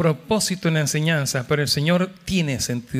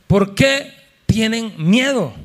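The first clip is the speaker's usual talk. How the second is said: slow stern rebuke; 5 semitones higher, 3 dB louder, slower than usual. Acted. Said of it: "El Señor no estaba muy contento".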